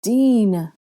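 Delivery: drawn-out, falling appeal; pleading. Acted. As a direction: plead with a friend for help. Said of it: "Hermione pleaded".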